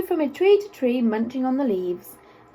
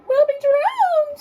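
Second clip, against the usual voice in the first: very high, slow squeak of panic; squeaking mouse; 7 dB louder, slower than usual. A narrator's reading. Frightened mouse squeaking to the animals. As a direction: squeal it like a tiny scared mouse. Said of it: "squeaked the mouse".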